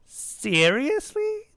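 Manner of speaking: drawn-out, high, incredulous question; child's scornful high squeak; dismissive. A narrator's reading. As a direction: stretch it out in disdain. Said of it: "said dismissively".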